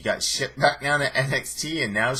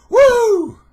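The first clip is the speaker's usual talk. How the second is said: high, loud wrestler's "woo"; Nature Boy woo; 13 dB louder than usual. Retold or imitated. Fan naming Charlotte throws in her father's catchphrase, high loud whoop. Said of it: "The Nature Boy's daughter".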